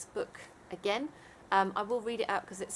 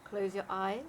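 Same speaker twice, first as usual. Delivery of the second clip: guided meditation; 4 dB quieter than usual. Acted. Leads a Yoga Nidra meditation.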